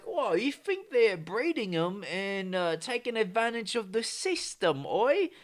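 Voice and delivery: put-on high, slowed-down caricature British accent; bad British accent. British suspecting cobra breeders of cheating, bad British accent. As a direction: put on a wobbly, hammed-up British voice. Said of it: "I don't know what fucking accent that is".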